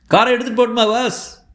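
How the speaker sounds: high-pitched eager request; calling out to boss. Acted. Asking boss as he leaves.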